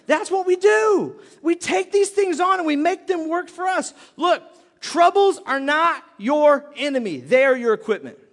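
loud, high motivational preaching; full-force preaching. Real, not acted. Preacher telling congregation to push back, forceful. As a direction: fire up the congregation like a hard coach.

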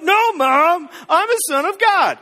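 high, loud protest to mom; whine-yell like a grown son pushing back; mock yelling. Thirty-year-old Jesus yelling at his mom, mock indignant. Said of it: "yelling at his mom".

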